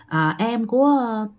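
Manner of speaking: normal talking voice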